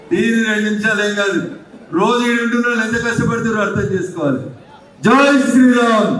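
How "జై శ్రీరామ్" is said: slow, drawn-out rally cry; slogan shout. Real, not acted. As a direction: call it out for the crowd to answer. Rousing crowd to answer louder. (నినాదం సాగదీసి అరవడం)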